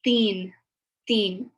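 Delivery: put-on "teen" begun with a soft dental th, not t; "teen" with a th, like thin. Shown as a demonstration. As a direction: model "teen" with the tongue on the teeth. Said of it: "it's pronounced teen".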